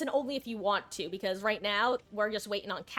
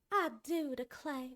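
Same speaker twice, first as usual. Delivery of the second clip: slow, higher Southern cowboy drawl on "I do declare"; Southern Belle phrase, cowboy accent; 5 semitones higher, 4 dB quieter, slower than usual. Shown as a demonstration. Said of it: "Southern Belle phrases with the more cowboy-esque accent".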